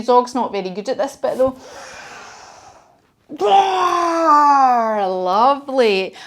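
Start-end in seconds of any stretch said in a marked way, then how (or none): 3.3-5.1 big, slow, shouted dragon roar; a long, loud demo roar for the children. Shown as a demonstration. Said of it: "The biggest dragon roar you can do".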